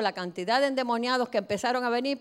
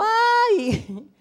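slow, drawn-out wail of a demon, pitched up and louder; crying demon; 4 semitones higher, 5 dB louder, much slower than usual. Acted. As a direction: wail it out slowly like a tormented spirit. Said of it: "dando gritos".

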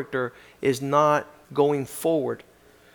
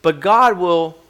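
louder preaching, higher than his opening talk; 6 semitones higher, 10 dB louder than usual. Acted. Preacher turns from the letter to the congregation, preaching. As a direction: drive it home to the congregation.

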